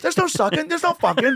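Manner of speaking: very high, emphatic Larry denial; squeaky Larry David protest; Larry David impression.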